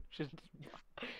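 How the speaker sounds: hushed, voiceless whisper; mock-sinister whisper. Player teasing friends about being the Russian spy, playful whisper.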